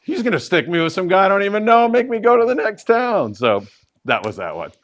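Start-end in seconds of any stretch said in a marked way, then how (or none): 0.0-3.3 put-on scared kid's thought. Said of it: "'cause he thought".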